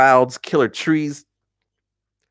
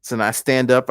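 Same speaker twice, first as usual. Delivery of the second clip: normal talking voice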